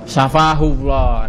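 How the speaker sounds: slow, drawn-out syafahullah, each syllable clear; slow demo. Shown as a demonstration. Teacher models the prayer for a sick brother, slow and clear. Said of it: "maka bagaimana doanya?".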